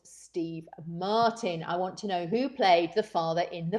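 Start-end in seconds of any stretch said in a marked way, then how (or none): 0.0-1.6 hushed, slow whisper of the last option; conspiratorial whispered reveal. Acted. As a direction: drop to a secret whisper. Quiz host hushes final option.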